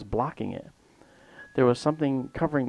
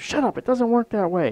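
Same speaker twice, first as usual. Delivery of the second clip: loud, high-pitched impatient retort; exasperated snap; 10 semitones higher, 6 dB louder than usual. Retold or imitated. Man rolling his eyes at the patient, exasperated snap. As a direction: shut down a foolish question, louder and higher. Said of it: "he rolls his eyes".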